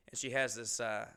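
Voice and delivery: usual speaking voice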